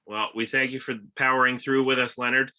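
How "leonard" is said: ordinary talk, nothing marked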